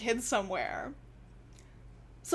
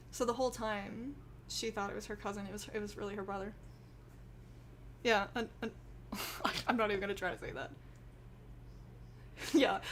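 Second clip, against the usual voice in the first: ordinary delivery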